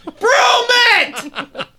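very high, drawn-out shout; mock curling yell. Imitating a curler yelling to sweepers, mock frantic shout. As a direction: holler it across the rink.

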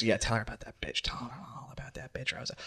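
hushed, sinking into a whisper; conspiratorial whisper. Acted. Ron prodding Danny about Rosa, sinister whisper. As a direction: egg him on under your breath.

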